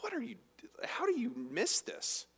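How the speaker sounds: what are you, how do you miss this, breathed out; incredulous whisper. Retold or imitated. Cleopas baffled at the stranger, hushed disbelief. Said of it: "I think he was just like".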